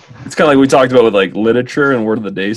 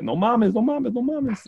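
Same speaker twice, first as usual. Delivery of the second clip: Mexican slang voice; 14 semitones higher, 6 dB quieter than usual. Retold or imitated. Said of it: "Club de Cuervos ... they're like".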